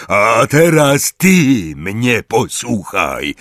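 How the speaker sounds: loud, slow, threatening growl; growled threat. Acted. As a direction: make them listen, menacing. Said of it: "warknął Hagrid".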